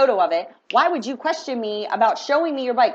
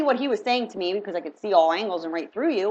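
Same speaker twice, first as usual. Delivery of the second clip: ordinary talk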